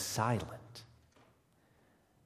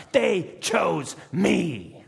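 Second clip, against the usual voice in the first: loud, high, very slow exultation; triumphant cry; 8 semitones higher, 7 dB louder, much slower than usual. Acted. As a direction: crow it word by word in disbelieving triumph. Freed prisoner exulting.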